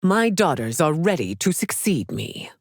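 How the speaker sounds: ordinary talk, nothing marked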